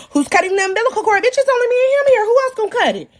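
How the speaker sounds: high, rapid-fire retort; mock exasperated. Mother answering who cuts the cord, mock exasperated. Acted impatient retort in delivery room.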